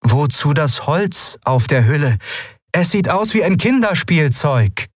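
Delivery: high-pitched sceptical character voice; critical call. A narrator's reading. Shayang disparages Endriel's ship on the roof, calling high.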